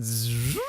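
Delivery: soft buzzing zip sound; mouth-made zipping noise, rising at the end; imitated tent zipper. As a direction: buzz like a zipper being pulled open.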